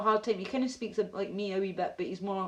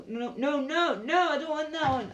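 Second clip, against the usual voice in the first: rapid-fire rising "no, no, no"; high, urgent protest; alarmed refusal; 7 semitones higher, 5 dB louder than usual. Real, not acted. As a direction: reject it in a rising panic. Rejecting an unwanted video on stream.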